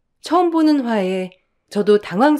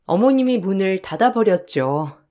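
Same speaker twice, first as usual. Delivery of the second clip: normal talking voice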